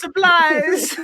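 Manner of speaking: loud, high shout of one word; jump-scare shout. Retold or imitated. Repeating the worker's startling yell, shouted. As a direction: yell it again to make them laugh. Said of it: "Ping jumps out and goes".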